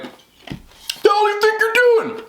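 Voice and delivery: high, drawn-out outraged holler; imitated angry yell. Homeowner confronting delivery lady over the doorbell, mock angry yell. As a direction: imitate a homeowner yelling at a thief. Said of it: "He really sounded like that".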